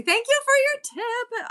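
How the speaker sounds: high, slow, drawn-out gushing thank-you; sing-song thanks. Real, not acted. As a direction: stretch it out sweetly, way up high. Thanked for a tip on live.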